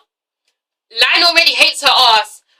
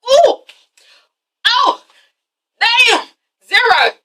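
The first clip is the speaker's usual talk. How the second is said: pitched-up stunned sympathy; shocked ooh; 10 semitones higher, much slower than usual. Real, not acted. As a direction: react like you just saw a savage burn. Own reaction to the burn.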